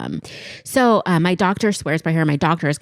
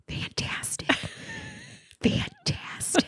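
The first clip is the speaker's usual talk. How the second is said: breathless, whispered fantastic through a laugh; mock enthusiasm, barely voiced; dreading the exam, whispering fantastic to herself; laughing whispered sarcasm; 11 dB quieter, much slower than usual.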